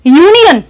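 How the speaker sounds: यूनियन with an opening "yoo"; clearly sounded यूनियन after spelling it; demonstrated pronunciation. Shown as a demonstration. Teacher reads out the spelled word, demonstrated यूनियन. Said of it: "once again".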